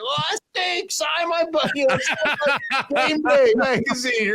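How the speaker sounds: high mock shout of a star-struck fan. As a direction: squeal the request like a shameless fan. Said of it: "You're like".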